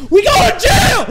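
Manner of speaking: high alarmed shout. Real, not acted. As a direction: yell it in mock panic. Reacting to girls' age.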